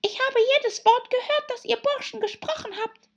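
very high, hoarse little dormouse; high, strained creature voice; weak, hoarse voice. A narrator's reading. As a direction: squeak it out hoarsely. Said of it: "mit schwacher, heiserer Stimme".

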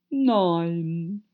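drawn-out, unimpressed no; dismissive caterpillar. A narrator's reading. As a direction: stretch the word out. Caterpillar curtly answers Alice.

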